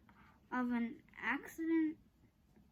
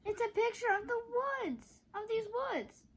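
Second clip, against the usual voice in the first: excited realization; 9 semitones higher than usual. A narrator's reading. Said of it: "said Jack".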